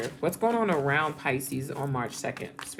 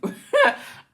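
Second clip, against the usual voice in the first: high, loud burst of laughter; short laugh, pitched well above speech; 7 dB louder than usual. Real, not acted. Reader amused by her own remark, laughing out loud.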